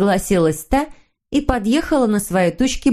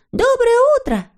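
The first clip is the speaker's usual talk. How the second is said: very high, piping greeting cry; cheery call. A narrator's reading. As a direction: call out good morning in a high voice. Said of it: "в один голос крикнули". (звонкий высокий оклик)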